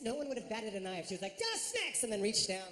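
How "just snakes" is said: "Just snakes" squawked high as one word; mimicked high, mashed "Just snakes"; garbled counter call. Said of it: "It sounded so much like double snakes".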